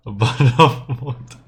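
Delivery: hammed-up British "bottle of water" with a laugh; British accent. Shown as a demonstration. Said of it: "British accent".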